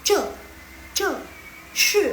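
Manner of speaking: "Ce, ce, shi" hit hard and loud from top to bottom; firm falling tones. Shown as a demonstration. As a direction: punch "Ce, ce, shi" like a firm command. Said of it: "jadi dia agak tegas". ("Ce, ce, shi" diucapkan tegas, ngegas, turun dari atas)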